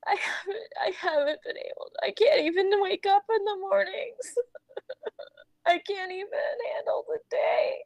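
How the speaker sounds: tearful confession breaking into whispers; crying, uneven and breathless; sobbing. Acted. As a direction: confess helplessness between sobs. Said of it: "Just sobbing now".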